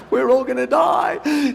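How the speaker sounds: drawn-out high wail of doom; hammed-up slow, high panic; mock panic. Comedian voicing a child who sees him coming, mock panic.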